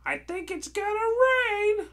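very high, loud, drawn-out witch cry; storybook witch Delia. Acted. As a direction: squeal it slowly in a put-on high voice. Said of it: "said Delia".

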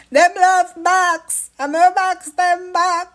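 loud, slow, high Jamaican auntie voice; Jamaican accent. Retold or imitated. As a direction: imitate the lady in the background, broad Jamaican. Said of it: "Hear her in the background".

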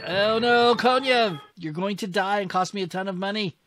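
alarmed plea at a doomed general; dismayed cry. Real, not acted. Player crying out as general Konyev is about to die.

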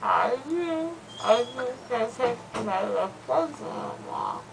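loopy slurred rambling; drugged, drowsy talk; after wisdom teeth. Real, not acted.

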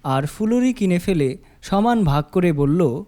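ordinary delivery, nothing marked

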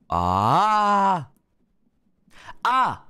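loud held high ah; high ah test. Shown as a demonstration. Streamer checking the compressor with loud high ahs. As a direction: belt a high note into the mic. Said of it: "What if I".

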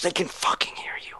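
tense whisper. Real, not acted. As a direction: hiss it under your breath. Hiding from enemies nearby.

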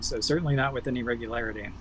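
ordinary talk

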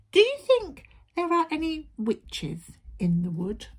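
high little-wolf voice, questioning; anxious little wolf. A narrator's reading. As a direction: ask worriedly in a small animal's squeak. Little wolf asks about witches.

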